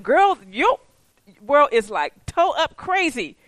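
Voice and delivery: high, loud disbelief at a friend; mock exasperation. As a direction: tell her off in playful exasperation.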